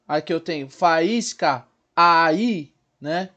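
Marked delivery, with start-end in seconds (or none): 0.7-2.7 "faísca" with A and stressed I apart; hiatus demo. Shown as a demonstration. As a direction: stretch fa-ÍS-ca, punching I in "faísca". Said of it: "Então eu tenho duas vogais". ("faísca" com o I forte e separado)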